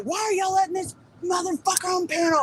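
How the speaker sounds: high angry shout; retold furious shout at the host. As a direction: yell it in outrage at the panel. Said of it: "I was furious".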